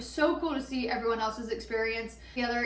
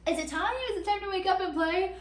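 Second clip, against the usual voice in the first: put-on higher, eager child asking to play; hyper and eager; 8 semitones higher than usual. On mushrooms, restless, asking friends to play, higher eager voice. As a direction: ask it like a kid who can't wait. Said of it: "I was kinda hyper".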